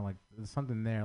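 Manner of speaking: ordinary delivery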